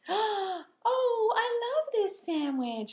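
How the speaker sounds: high, gushing puppet delight; playing with a child, hammed-up high delight; mock delight. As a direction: overplay the joy of a pretend sandwich. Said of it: "And I come out and I go".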